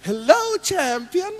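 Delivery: high, drawn-out greeting of an adoring wife; gushing wife. Acted. Preacher as soldier's wife on video call, gushing. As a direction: sing out the hello, proudly. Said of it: "istrinya video call".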